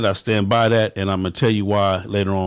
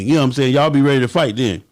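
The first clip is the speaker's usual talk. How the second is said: usual speaking voice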